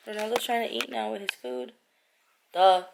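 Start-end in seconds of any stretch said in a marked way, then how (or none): none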